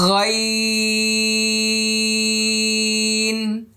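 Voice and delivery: long held ghayn, sung high; Arabic: غين ممدود; Arabic letter name. Shown as a demonstration. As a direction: stretch the throat sound out slowly. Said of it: "আগে এই আওয়াজটা practice করে নেবেন".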